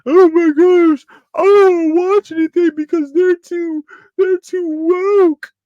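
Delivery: high, loud, slow mock crying whine; fake-sob it like a whiny critic; mock whining critic. Vlogger mocking anti-woke viewers with fake crying whine. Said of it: "I truthfully dislike".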